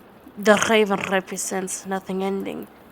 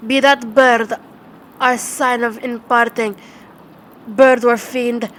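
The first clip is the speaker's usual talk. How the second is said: loud, raised accusation at the bird; shrieked; 3 semitones higher, 8 dB louder than usual. Acted. Narrator shrieking at the raven, loud. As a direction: rise up and hurl it at the fiend. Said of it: "I shrieked".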